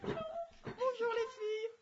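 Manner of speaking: sing-song high and slow; high squeaky greeting. Acted. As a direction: squeal it out playfully. Playful greeting to the girls.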